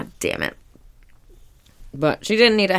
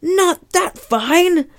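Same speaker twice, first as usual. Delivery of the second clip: very high, slow cartoon crab voice; Mister Krabs; 13 semitones higher, 4 dB louder, much slower than usual. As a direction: stretch the words out high and fussy. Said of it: "Mister Krabs is like".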